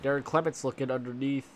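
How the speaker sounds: blocked nose, congested voice; stuffy nose. Real, not acted. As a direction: talk through a stuffy cold nose. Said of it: "I'm sorry if I sound stuffy".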